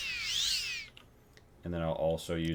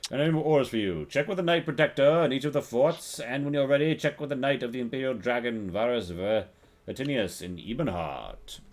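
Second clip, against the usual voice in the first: lightly voiced imperial commander; NPC voice; 6 semitones higher, 3 dB louder than usual. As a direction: read the orders like a stiff officer. Voicing NPC orders.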